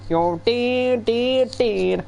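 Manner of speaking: put-on jokey hillbilly accent taking the piss. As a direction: imitate a trailer-park hillbilly, cringy and hammed up. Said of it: "we would do a hillbilly voice".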